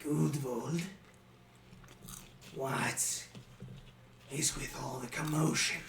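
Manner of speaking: put-on soft, low-key old goblin asking; quiet goblin. Old goblin asking Gudvold about the commotion, soft and calm. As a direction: speak quietly, unbothered by the noise. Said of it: "and say".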